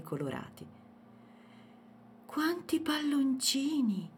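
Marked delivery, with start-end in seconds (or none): 2.3-4.1 high, slow wonder; amazed exclamation. A narrator's reading. Girl sees the lanterns from the window, amazed. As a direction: marvel in a high, drawn-out voice. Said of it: "esclamò sbalordita".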